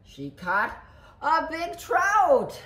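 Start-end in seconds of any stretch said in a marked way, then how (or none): 1.2-2.6 loud, high, drawn-out punchline; hammed-up storybook reveal; big reveal. A narrator's reading. Punchline reveal after pause.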